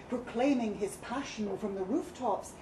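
ordinary delivery

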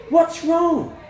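high, loud alarmed question; startled. A narrator's reading. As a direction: ask in startled, motherly alarm. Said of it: "She looked startled at my entrance".

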